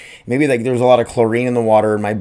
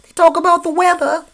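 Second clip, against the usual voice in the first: high, louder put-on old-timer voice; mock a fussy old person griping about the weather; teasing old-man impression; mocking old people; 4 dB louder than usual. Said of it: "that's what old people do".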